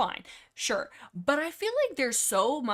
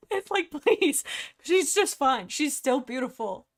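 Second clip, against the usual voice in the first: indignant plea; 5 semitones higher, 6 dB louder, slower than usual. Real, not acted. Defends Dappletail.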